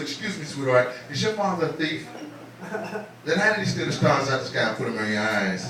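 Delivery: soft, lightly played smooth talker; corny pickup line. Man hitting on a girl, corny pickup line. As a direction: drop the volume for a cheesy come-on. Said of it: "Yeah, that's corny".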